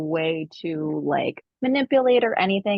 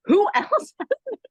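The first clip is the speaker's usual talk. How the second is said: higher, laughing through the friend's question; laughing retold question; 13 semitones higher, 4 dB louder than usual. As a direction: ask it while cracking up. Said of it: "And he was like".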